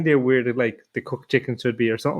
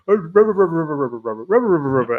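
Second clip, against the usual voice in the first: penguin mumble; 2 semitones higher, faster than usual. Retold or imitated. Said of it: "like a penguin format going".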